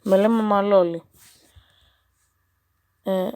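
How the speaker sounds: ordinary delivery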